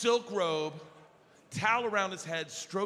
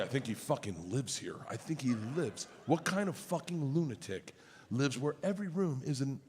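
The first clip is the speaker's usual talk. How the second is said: low, muttered dad complaints; a hint of a cranky father whispering to son, low; grumbling dad; 9 semitones lower, 4 dB quieter than usual. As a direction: grumble it under your breath. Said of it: "He's like".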